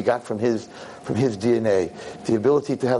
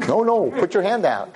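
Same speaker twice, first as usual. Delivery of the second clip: higher-pitched insistent command; urgent retold demand; 8 semitones higher than usual. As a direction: cut off the refusal and insist. Retold girl insisting, overriding refusal.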